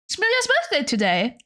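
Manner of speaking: bright, high, fast repeat of "It's Melia's birthday today"; singsong birthday announcement. Shown as a demonstration.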